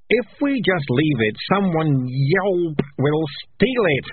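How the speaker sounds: high, drawn-out wail. A narrator's reading. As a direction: whine in protest, stretching the words. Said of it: "wailed Morris".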